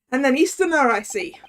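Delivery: Scottish governor sizing up a foreigner; Scottish accent. Acted. Governor notes the visitor is an Easterner, Scottish accent. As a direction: remark pointedly in a Scots accent. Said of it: "okay, we gotta do Scottish".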